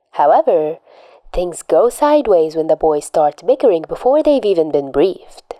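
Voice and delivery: usual speaking voice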